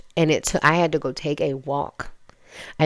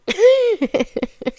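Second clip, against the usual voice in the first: squealing high laugh, louder; 6 dB louder than usual. Real, not acted. New mom laughing at people being stupid, high and loud.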